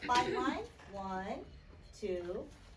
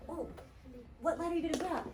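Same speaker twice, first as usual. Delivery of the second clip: ordinary delivery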